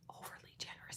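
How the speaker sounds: unvoiced whisper; whispered aside. Real, not acted. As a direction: whisper it as a quick correction.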